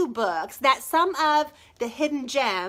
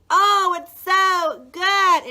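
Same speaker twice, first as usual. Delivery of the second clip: gushing; 5 semitones higher, 7 dB louder, much slower than usual. Real, not acted. Gushing over the book.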